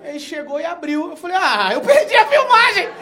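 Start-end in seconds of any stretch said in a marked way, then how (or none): 1.4-2.9 very high, loud, slow wail; dismayed. Retold or imitated. Missing the filmed gift delivery, a very high slow wail.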